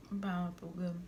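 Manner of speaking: ordinary talk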